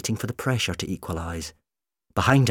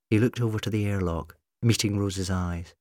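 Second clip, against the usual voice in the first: plain reading voice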